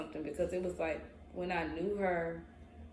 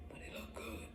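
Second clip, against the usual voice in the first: breathy whisper; whispered temptation; 14 dB quieter than usual. Retold or imitated. Tempted inner voice, guilty whisper.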